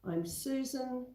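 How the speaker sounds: normal talking voice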